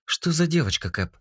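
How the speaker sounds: high, pleasant female voice. A narrator's reading. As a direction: voice a friendly female AI. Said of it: "голос нового эскина. Приятный, женский".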